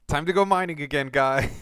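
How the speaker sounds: talking while crunching chips; words through a mouthful of chips. Real, not acted. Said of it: "pardon the crunching".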